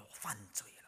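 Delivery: hushed whisper of guilt; fearful confession. Retold or imitated. Josiah admitting his sin to God, whispered. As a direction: breathe it out in remorse. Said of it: "他痛悔，他害怕".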